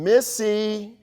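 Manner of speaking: an educator silently alarmed; mock scandalized thought; inner protest. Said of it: "I'm thinking".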